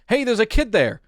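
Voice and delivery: high alarmed shout of warning; yell. A narrator's reading. Onlooker yelling a warning at the dart thrower, loud alarm. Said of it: "Someone yelled".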